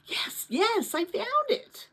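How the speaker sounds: high, breathless excitement; eager boy. A narrator's reading.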